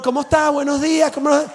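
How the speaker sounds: upbeat waiter hello; cheery waiter greeting. Retold or imitated. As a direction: greet the customer all smiles.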